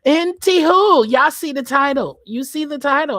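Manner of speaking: loud, high burst to the viewers; hyped shout to the chat; excited callout. Real, not acted. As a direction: call it out like big news. Calling out to viewers.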